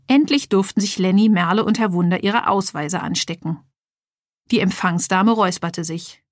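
ordinary delivery

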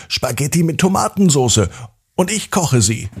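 spirited, self-assured announcement; determined insistence. A narrator's reading. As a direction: insist firmly on the plan. Determined girl insisting to her mother.

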